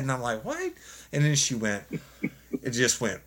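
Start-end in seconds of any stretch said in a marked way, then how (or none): 0.4-0.7 very high-pitched mock shock; stunned disbelief. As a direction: squeak it out in retold disbelief. Retold stunned reaction to her confession.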